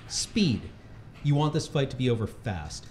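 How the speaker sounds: normal talking voice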